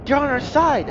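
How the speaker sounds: high, excited exclamation; exclaiming. A narrator's reading. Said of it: "exclaims G D".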